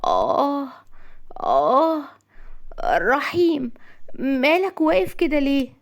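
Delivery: high-pitched groaning female voice; put-on high voice of an ailing woman; moaning woman. A narrator's reading. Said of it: "بدأت رزان تتأوه".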